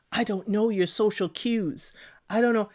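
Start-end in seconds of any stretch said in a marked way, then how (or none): none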